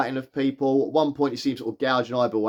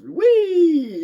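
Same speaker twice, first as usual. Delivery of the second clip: very high, louder wee of delight; gleeful squeal; 13 semitones higher, 6 dB louder than usual. Retold or imitated. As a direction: whoop like a gleeful imp on a skateboard. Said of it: "He's sort of".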